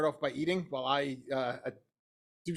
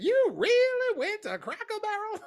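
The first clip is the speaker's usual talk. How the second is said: Southern mama voice; 4 dB louder than usual. Retold or imitated. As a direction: ham up a Southern mom who can't believe it. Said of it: "she did her best Southern mama voice".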